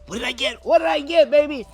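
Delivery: high squealing "what did I get"; mock excited squeal. Plant collector squealing over mail. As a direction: squeal it like a kid at Christmas.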